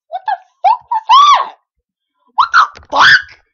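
very high, loud disbelieving shout; real stunned outburst; shocked yell. Real, not acted. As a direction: shriek it in shock. Confused by sudden event.

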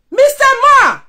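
loud high call-out; shouting at him. Real, not acted. Calling out a rival man, loud and high. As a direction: bellow his title.

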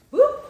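high, loud whoop on the jump; sharp high whoop. Shown as a demonstration. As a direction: yelp it as you leap. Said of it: "demonstrating the jump".

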